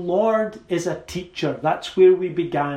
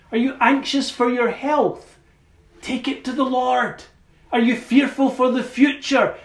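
building appeal; 6 semitones higher than usual. Real, not acted. Preacher urging burdened listeners to pray, building appeal. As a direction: press the refrain home, rising in pitch and force.

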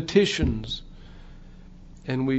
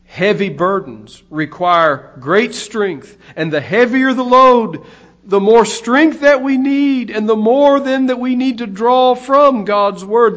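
louder, higher, driving sermon delivery; impassioned preaching; 7 semitones higher, 8 dB louder than usual. Real, not acted. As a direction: build the exhortation with full pulpit force. Preaches at raised force.

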